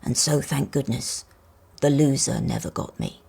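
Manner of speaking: low, steady elder voice; an old toy car giving a warning; wise old toy car. Acted. Matchbox car voice.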